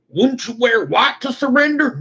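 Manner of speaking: a confused sidekick asking; second cowboy. Pretend.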